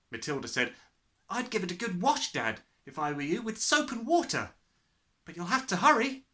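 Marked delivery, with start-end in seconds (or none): 1.3-6.2 put-on higher, composed young daughter; lightly voiced girl. A narrator's reading. Matilda advising Dad to wash it, lightly voiced girl. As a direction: offer advice in a light girlish voice.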